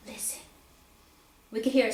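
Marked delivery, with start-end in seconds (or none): 0.1-0.4 barely voiced, very soft; hushed. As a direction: tell them to listen, almost silent. Mother hushing at the grave.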